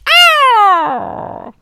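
very high, loud piercing hawk cry; hawk screech. Pretend. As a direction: screech like a hawk over a canyon. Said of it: "Do it".

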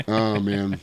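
ordinary talk, nothing marked

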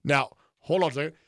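ordinary talk

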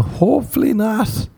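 ordinary talk

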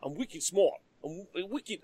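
tentative Boston accent; Bostonian accent. Shown as a demonstration. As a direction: attempt a Bostonian, faltering. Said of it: "I could do Bostonian".

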